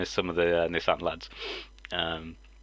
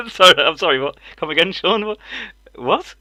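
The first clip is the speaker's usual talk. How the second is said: high, loud disbelieving double-take; incredulous; 9 semitones higher, 10 dB louder than usual. Retold or imitated. Asking Sean to repeat himself, incredulous. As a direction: re-enact amused disbelief through laughter. Retelling his disbelieving reaction, laughing.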